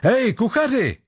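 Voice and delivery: high, hearty call; boisterous sailor. A narrator's reading. Sailor barging in to call the cook, boisterous. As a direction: burst in hailing someone, voice pitched well up.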